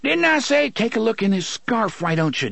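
high-pitched protesting villain; high, urgent self-defence; Gasher's agitated voice. A narrator's reading. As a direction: rattle it off high, defending yourself. Gasher defends himself in panic.

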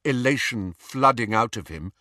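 plain reading voice

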